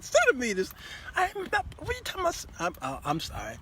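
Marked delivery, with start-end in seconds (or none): 0.0-2.5 put-on high, fast incredulous girl voice; daughter's high voice. Daughter mocks her father's question, high and fast. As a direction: fire it back, high and baffled.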